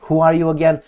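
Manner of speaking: ordinary talk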